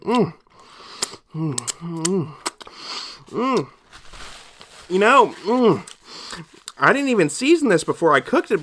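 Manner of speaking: usual speaking voice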